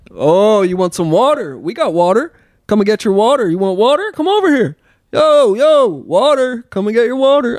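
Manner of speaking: loud, high mock street heckling; heckling hooligans. Hooligans calling to a lone walker, loud mocking shouts. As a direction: call out rowdily, imitating a taunting gang. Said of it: "a group of, uh, hooligans".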